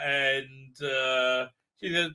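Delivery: ordinary delivery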